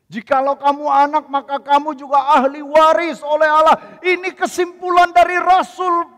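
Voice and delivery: loud, high preaching to the congregation; fiery preaching. Acted. Pastor proclaiming God's great project to congregation, fiery preaching.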